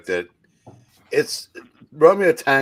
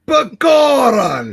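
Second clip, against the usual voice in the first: drawn-out, much higher wail of Goron; mock anguished cry; 12 semitones higher, 8 dB louder, much slower than usual. Friend protests losing the Goron role, mock-anguished wail. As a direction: cry the name like it breaks your heart.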